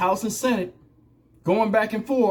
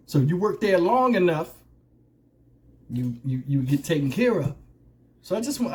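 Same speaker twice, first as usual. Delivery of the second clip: normal talking voice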